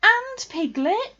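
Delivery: high, loud, drawn-out exclamation; excited. A narrator's reading. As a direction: cry out the discovery with glee. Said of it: "said Christopher Robin excitedly".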